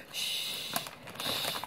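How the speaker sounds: long soft shush; imitated hushing. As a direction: mimic a child shushing Mama. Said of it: "Y'all know how she be hushing me".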